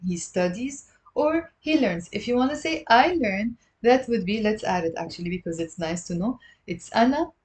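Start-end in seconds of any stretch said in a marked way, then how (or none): none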